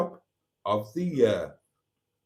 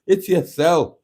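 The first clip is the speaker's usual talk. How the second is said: put-on loud, higher go at a Scottish accent; botched Scottish accent; 5 semitones higher, 8 dB louder than usual. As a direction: have an unconvincing try at Scottish. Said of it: "I can't do Scottish".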